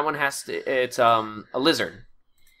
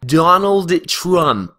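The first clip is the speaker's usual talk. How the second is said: slow drawn-out warm-up; 7 semitones higher, 9 dB louder, much slower than usual. Shown as a demonstration. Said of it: "how I warm up before my videos".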